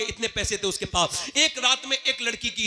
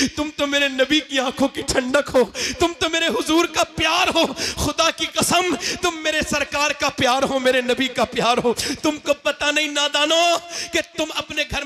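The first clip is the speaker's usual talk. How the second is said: soaring preacher's cry; impassioned preaching; 7 semitones higher than usual. Acted. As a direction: lift the voice high and plead with love. Impassioned appeal to youth.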